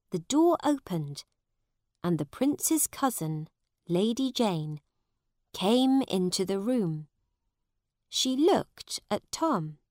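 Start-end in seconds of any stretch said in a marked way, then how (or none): none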